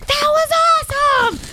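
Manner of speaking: excited. Retold or imitated. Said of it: "she's like".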